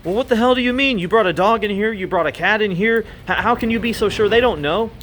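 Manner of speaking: high-pitched, mock-outraged customer; acted indignant protest. Duck owner challenging the vet's tests, acted indignation. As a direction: protest in disbelief, pitch jumping up.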